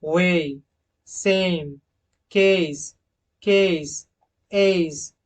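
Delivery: each word with a long ए; model words. Shown as a demonstration. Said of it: "okay".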